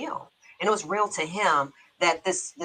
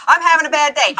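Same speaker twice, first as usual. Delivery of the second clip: loud, higher mock plea of a bad day; mock distressed; 8 semitones higher, 10 dB louder, faster than usual. To her therapist on the phone, a loud mock plea. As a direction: wail it like a friend needing help.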